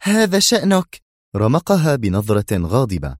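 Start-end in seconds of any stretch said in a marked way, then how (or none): 0.0-1.0 high female voice, tired sigh; sighing woman. Acted. Zosha sighing at Yuri, high and weary. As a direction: let it out with a sigh. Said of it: "زفرت مرة أخرى قائلة".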